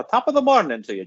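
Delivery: a cheery stage Irish hello, admittedly half Scottish; Irish accent. Retold or imitated. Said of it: "I don't do a great Irish accent".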